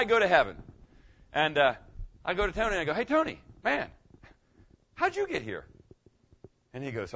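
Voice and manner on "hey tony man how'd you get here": high-pitched, friendly hail; surprised greeting. Acted. Preacher greeting Tony in heaven, surprised. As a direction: greet a pal with wondering, raised pitch.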